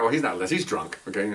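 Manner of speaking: ordinary talk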